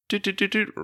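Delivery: high, soft doot sounds; imitated ring tone. Calling the theater, high soft ring imitation. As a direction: mimic the phone line ringing.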